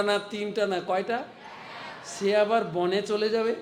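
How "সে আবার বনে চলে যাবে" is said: normal talking voice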